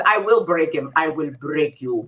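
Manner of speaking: low, slow Russian villain line; menacing Drago, low and slow; Russian accent. Shown as a demonstration. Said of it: "And one more".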